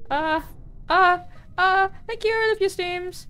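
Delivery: high, overwhelmed reading; touched squeal. Real, not acted. Streamer reading a sweet viewer message, high and touched.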